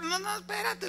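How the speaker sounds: devil begging to be heard; sad devil. Retold or imitated. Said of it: "le dice".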